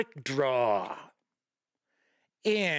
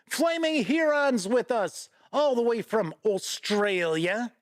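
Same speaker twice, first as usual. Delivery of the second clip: lightly put-on Aussie twang, higher and brighter; a playful stab at an Australian accent; a hint of an Aussie accent greeting a viewer; half-assed Aussie accent; 6 semitones higher than usual. Shown as a demonstration. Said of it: "I don't know why I always say it like that".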